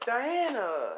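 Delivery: high, drawn-out cry of a name; delighted greeting. Acted. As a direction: sing out a mother's welcome. Queen greets her returning daughter.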